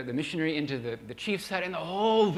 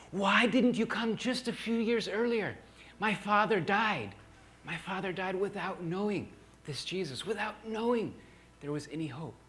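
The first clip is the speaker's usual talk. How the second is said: grieving plea; 5 semitones higher than usual. Retold or imitated. Grandpa pleads grieving.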